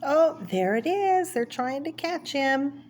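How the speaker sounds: normal talking voice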